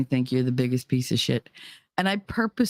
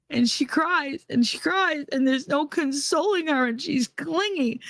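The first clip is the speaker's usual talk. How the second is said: desperate plea; 9 semitones higher than usual. Retold or imitated.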